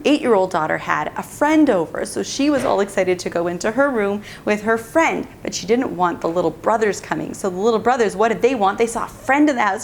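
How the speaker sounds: usual speaking voice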